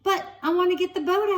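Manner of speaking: high, fast whiny protest. Acted. Tom to Stella about the boat, high fast protest. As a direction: beg to keep digging. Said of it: "he protested".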